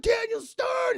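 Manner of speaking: high, loud drawn-out cry of a name; alarmed shout. Retold or imitated. Burglar to his falling partner, alarmed shout. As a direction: yell down the stairs in mock horror. Acting Pesci calling fallen partner.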